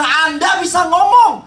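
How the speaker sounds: retold outburst at a comforter; angry retort. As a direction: fire it back at the well-meaning friend. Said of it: "Saya bilang".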